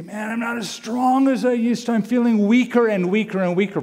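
half-mocking a tired believer's complaint; a hint of a worn-out complainer. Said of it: "And you say".

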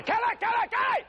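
high calling shout. Retold or imitated. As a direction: yell her name across the house. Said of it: "when I raise my voice".

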